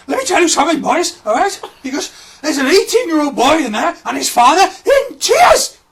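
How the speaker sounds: high, loud, strained scolding; exasperated head of sixth form. Retold or imitated. Head of sixth form scolds the boys, high loud strained. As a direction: lecture the boys, voice cracking with outrage.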